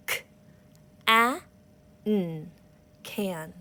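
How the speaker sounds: "K, a, n, can" each sound apart, then blended; one sound per finger, then the whole word. Shown as a demonstration. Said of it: "I'm gonna show you how to break up your words".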